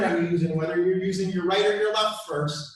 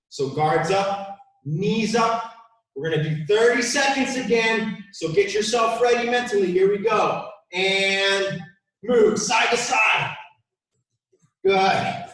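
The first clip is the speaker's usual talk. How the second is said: loud, rallying shouts over the workout; aerobics instructor; 3 semitones higher, 5 dB louder than usual. Real, not acted. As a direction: pump up the class with big energetic calls. Coach shouting through a drill.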